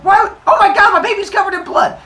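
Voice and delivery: very high, loud, fast shriek; panicking mother screaming; hammed-up frantic horror; horrified yelling. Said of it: "start yelling back and forth".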